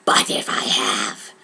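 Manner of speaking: low menacing whisper; Brom's threat. Acted. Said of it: "Brom said".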